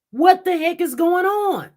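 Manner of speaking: high, loud exasperated cry; outraged disbelief. Real, not acted. To her viewers, exasperated outrage at the case. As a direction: throw up your hands in disbelief.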